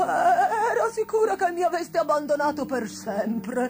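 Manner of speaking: loud, high sobbing voice, easing towards the end; tearful old witch. A narrator's reading. Old witch who felt abandoned, crying. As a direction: wail it, then gradually recover. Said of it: "gridò piangendo".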